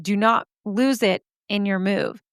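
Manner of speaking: normal talking voice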